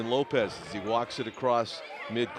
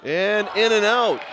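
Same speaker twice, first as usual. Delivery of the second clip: higher, louder near-miss call; excited play-by-play call; 6 semitones higher, 6 dB louder than usual. Real, not acted. Announcer calling a close miss, excited. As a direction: lift it as the shot rims out.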